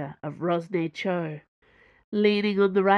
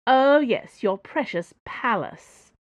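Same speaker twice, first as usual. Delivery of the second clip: scornful, mocking reply; 5 semitones higher, 5 dB louder than usual. A narrator's reading. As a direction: sneer at his precious palace. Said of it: "Madame Su mocked".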